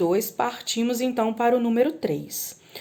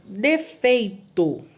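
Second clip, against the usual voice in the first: slow dictation; much slower than usual. Shown as a demonstration. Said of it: "dictation to students".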